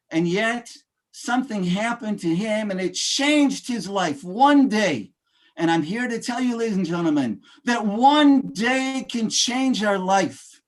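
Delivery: rousing speaker, building to big peaks on key phrases; motivational speech. Acted. Speaker rallying ladies and gentlemen, rousing motivational delivery. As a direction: preach it to the room like a keynote.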